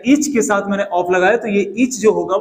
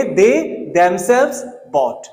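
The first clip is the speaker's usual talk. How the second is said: very slow, ^themselves leaned on; slow drilled example; much slower than usual. Shown as a demonstration. Teacher drilling class slowly: slow emphatic example. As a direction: spell it out slowly for students. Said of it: "क्या बोलेंगे?".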